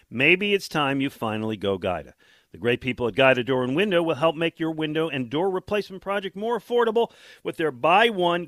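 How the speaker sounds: upbeat ad read, punchy; infomercial. Acted. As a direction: sell it like a radio pitchman. Host reads live ad.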